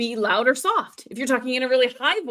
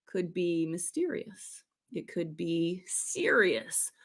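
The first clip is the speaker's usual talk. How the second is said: lower, softer and slow; low and serious; 5 semitones lower, 10 dB quieter, slower than usual. Shown as a demonstration. Teacher demoing a low, serious tone. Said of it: "The lower".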